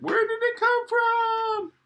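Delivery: put-on very high squeaky little girl; high piglet voice, alarmed; Peppa's voice. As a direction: pipe it up like a tiny piglet.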